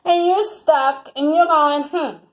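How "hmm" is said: normal talking voice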